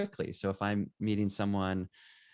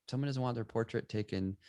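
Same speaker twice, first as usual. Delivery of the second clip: usual speaking voice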